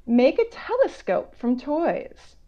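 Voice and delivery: slow, curious read-aloud; reading a picture book. Acted. Author reading to children, slow and curious. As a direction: draw the kids into the mystery.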